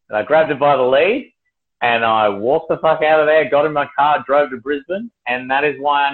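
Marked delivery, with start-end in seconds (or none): none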